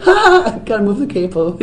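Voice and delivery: high, embarrassed stage laugh into an excuse; mock flustered. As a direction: play up an awkward onstage fumble. Acting flustered onstage key mishap.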